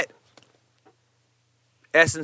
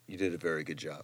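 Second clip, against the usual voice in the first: put-on soft, lightly done French accent; 6 semitones lower, 13 dB quieter than usual. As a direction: say it like a French chef. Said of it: "in a very French accent".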